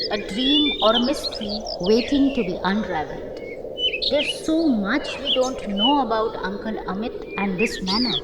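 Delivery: wife musing softly about the mystery, lightly voiced; softly voiced young woman. Karishma voiced in story.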